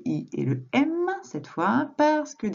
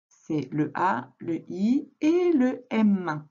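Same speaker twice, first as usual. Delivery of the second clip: slow spelling; much slower than usual. Shown as a demonstration. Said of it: "teacher spells slowly".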